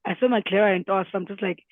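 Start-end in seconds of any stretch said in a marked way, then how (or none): none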